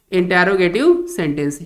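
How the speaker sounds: ordinary delivery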